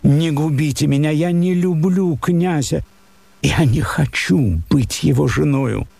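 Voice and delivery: high, begging voice near tears; plaintive pleading. A narrator's reading. Daughter begs father not to marry her off, pleading. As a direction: beg him not to ruin her. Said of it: "жалобным голосом".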